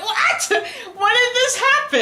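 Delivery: high, slow disbelieving what; incredulous. Real, not acted. Vlogger reacting to the pregnancy twist, high incredulous. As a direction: stretch out the disbelief.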